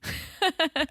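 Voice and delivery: a shrill burst of laughter; high squeal of laughter. Real, not acted. Laughing high at the Yolanda joke.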